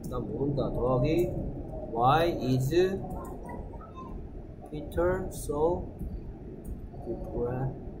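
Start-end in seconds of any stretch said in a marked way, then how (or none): 1.9-7.9 very slow, fading English reading. Shown as a demonstration. Teacher splitting the sentence aloud for students, very slow. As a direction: drag out each word as you read. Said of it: "slow model reading".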